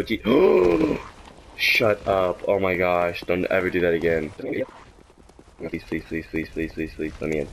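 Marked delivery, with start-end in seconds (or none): 5.6-7.5 low, soft begging under breath; low murmured begging; quiet pleading. Real, not acted. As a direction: mutter your pleas. Begging game to open.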